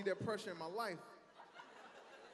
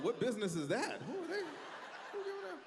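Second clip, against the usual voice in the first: mock outrage; 9 semitones higher than usual. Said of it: "Y'all like".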